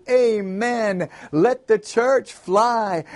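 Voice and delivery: high, stretched congregational cry; crowd shouting. A narrator's reading. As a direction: echo it like a crowd gone wild. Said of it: "jumped on their feet and shouted".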